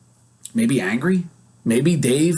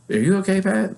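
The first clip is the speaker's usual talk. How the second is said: higher, lightly voiced worried wife; concerned wife; 7 semitones higher than usual. Wife asking her tired husband, lightly voiced and higher. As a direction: ask gently as his wife. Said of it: "And she's like".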